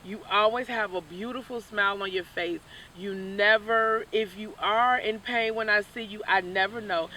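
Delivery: ordinary talk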